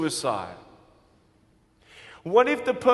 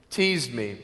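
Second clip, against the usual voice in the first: usual speaking voice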